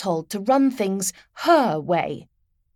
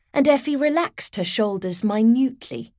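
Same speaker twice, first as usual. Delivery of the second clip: usual speaking voice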